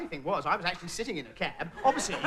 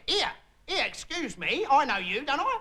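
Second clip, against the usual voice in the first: cabbie; 7 semitones higher, slower than usual. Retold or imitated. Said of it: "He said".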